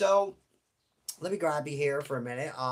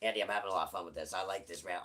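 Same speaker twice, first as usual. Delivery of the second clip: second voice; 7 semitones lower, 7 dB quieter, faster than usual. Pretend. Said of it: "I do the two voices".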